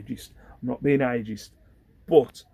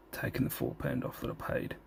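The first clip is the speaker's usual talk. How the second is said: whispering; 10 semitones lower, 8 dB quieter than usual. Real, not acted. Whispering late at night.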